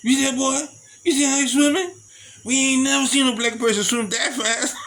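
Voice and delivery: put-on high, loud Southern accent, laughing at the end; exaggerated Southern. Southern onlookers marvelling at the fast swimmer, loud Southern accent. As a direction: ham up the Southern accent in amazement. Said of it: "Southern be like".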